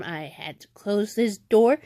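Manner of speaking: ordinary talk, nothing marked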